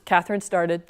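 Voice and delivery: plain reading voice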